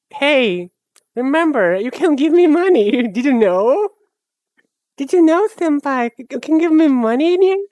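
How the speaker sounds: high cutesy wheedle for money; cutesy begging voice. Retold or imitated. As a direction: coax the viewers, sweet and high. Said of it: "call for action".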